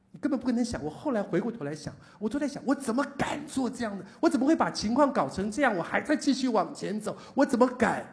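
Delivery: high, loud, incredulous preaching; preaching at full force. Acted. Pastor to congregation, loud, high, incredulous preaching. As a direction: hammer home the disbelief at himself.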